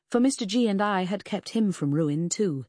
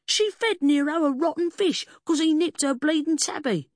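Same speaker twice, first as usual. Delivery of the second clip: high, loud cockney accusation; high-pitched indignant rant; outraged squeal; 11 semitones higher, 5 dB louder than usual. A narrator's reading. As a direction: squeal your side of the quarrel. Furious man shouts his accusation.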